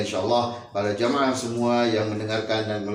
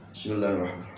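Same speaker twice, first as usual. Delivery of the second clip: much softer, murmured prayer; silent prayer; 2 semitones lower, 15 dB quieter, faster than usual. Real, not acted. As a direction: pray under your breath. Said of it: "saya ngomong asror ya".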